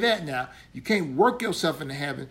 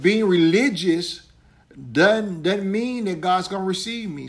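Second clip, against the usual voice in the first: preaching; 2 semitones higher than usual. Acted. Preaching at full force.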